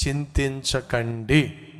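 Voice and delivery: slow, deliberate scripture quote; scripture reading. Shown as a demonstration. Preacher quoting God's command, slow and deliberate. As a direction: read God's words slowly. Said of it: "దేవుడు సెలవిచ్చాడు".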